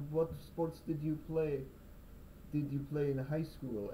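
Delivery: light mock Russian accent, slow and pointed. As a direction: ask it like a blunt Russian hairdresser. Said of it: "she's gonna be Russian".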